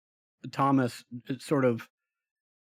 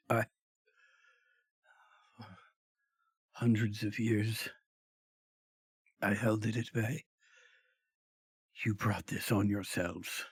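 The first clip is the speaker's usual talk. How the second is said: dying creature voice; 3 semitones lower, 6 dB quieter than usual. Acted. Dying creature's last words.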